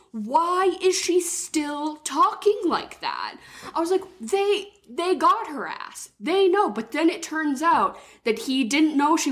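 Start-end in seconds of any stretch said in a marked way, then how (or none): 0.1-6.7 high, slow, incredulous asking; playing her own confused self in the cinema; high, slow "why is she still talking like that"; baffled disbelief. Baffled at the fake accent.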